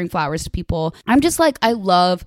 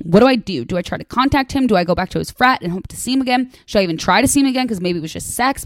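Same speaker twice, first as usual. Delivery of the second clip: ordinary delivery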